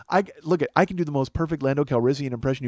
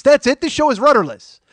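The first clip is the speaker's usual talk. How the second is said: excited; 11 semitones higher, 8 dB louder than usual. Real, not acted. Sudden realization, exclaimed.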